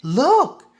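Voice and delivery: loud, high excited shout; child shouts. A narrator's reading. Child shouts to the others on the train, loud and high. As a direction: yell it out, pointing at the elves. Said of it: "shouted one of the children".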